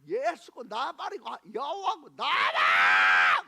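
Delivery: slow, loud, drawn-out yell; a drunk mother yelling at the cross; furious shout. Retold or imitated. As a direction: roar it as a challenge to heaven. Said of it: "내가 막 따지듯이 한 거죠".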